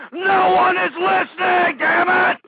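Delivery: high, slow, loud yell; furious scream. Real, not acted. Host despairs that no one is listening, furious scream. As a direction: stretch it out at full volume. Climax of the tirade.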